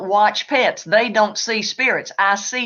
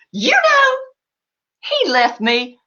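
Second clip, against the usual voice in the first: high, thrilled opener that settles quickly; a hammed-up squeal of excitement; mock excited owner; 5 semitones higher, much slower than usual. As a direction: gush like an owner with news. Mimicking owner sharing signs.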